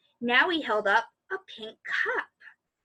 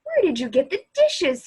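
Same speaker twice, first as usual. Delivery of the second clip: surprised cry; 4 semitones higher, 4 dB louder than usual. A narrator's reading. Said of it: "he cried when he saw the shelf".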